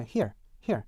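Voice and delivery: American here, one vowel plus R. Shown as a demonstration. Teacher models first example, American rhotic here. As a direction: say here with a clear final American R. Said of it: "일반 미국 영어에서는".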